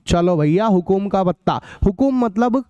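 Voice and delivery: ordinary talk, nothing marked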